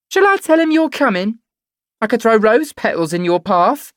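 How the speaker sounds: put-on high, loud teasing girl's voice; mocking Davina. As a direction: taunt her with spiteful glee.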